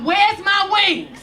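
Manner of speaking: high, slow panicked yell; screaming. Retold or imitated. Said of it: "started screaming".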